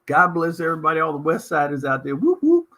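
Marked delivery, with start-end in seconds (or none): 2.2-2.7 high, loud whoop for the neighbourhood; hype call. Real, not acted. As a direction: cheer it out like a crowd chant. Shout-out to west siders.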